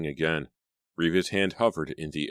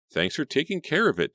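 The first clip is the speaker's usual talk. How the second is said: higher, sugary gratitude; gushing thanks; 11 semitones higher than usual. A narrator's reading. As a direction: thank Daddy with eager delight. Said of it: "Riva gushed".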